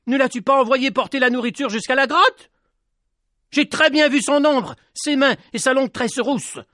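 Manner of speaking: put-on a boy exploding at her; furious shout. A narrator's reading. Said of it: "Il explosa de rage et se mit à lui crier".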